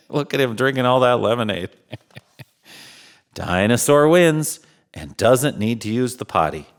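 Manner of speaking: plain reading voice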